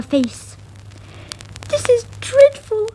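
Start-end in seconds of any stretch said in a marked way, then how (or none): 1.7-2.9 very high, tearful mouse voice; sobbing. Acted. Miranda to her cousin after the cat, high tearful. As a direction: wail it as if in tears. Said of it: "she sobbed".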